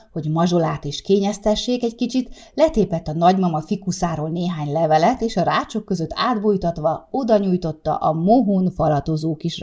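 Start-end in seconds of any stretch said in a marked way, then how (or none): none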